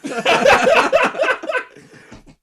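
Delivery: high, loud burst of laughter; cracking up hard, high and loud. Real, not acted.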